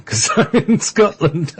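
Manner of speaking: laughing. Real, not acted. Host teases guest about being southern, laughing. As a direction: say it with a laugh breaking in.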